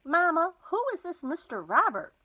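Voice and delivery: a worried daughter asking her mother about a stranger. A narrator's reading. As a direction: press Mama anxiously for an answer. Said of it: "Mama sounded worried".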